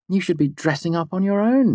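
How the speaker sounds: high female friend's chiding; chiding woman. A narrator's reading. Devi tells Fela to dress up, high and chiding. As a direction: chide her in a high voice.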